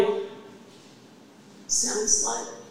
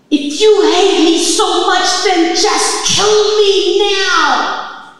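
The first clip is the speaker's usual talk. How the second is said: high, slow, screamed plea to heaven; desperate scream; 10 semitones higher, 10 dB louder, much slower than usual. Retold or imitated. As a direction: cry out to the sky in despair. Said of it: "I screamed in my head".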